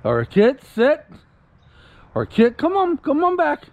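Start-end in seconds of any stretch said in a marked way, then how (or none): 0.2-1.0 firm raised call to the dog; dog command demo. Shown as a demonstration. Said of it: "all I have to do is say". 2.3-3.6 high, bright call to the puppy; calling the dog back. Shown as a demonstration. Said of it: "all I have to do is say".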